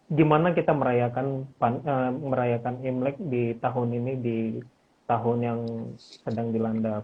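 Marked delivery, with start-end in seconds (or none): none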